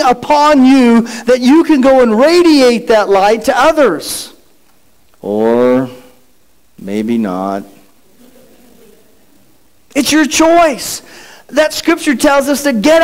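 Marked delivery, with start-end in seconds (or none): 5.2-7.6 low, soft, mopey drop; glum Eeyore aside. Acted. Preacher undercutting his own cheerful verse, mopey low drone. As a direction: deflate it like a gloomy donkey. Gloomy mock after uplifting verse.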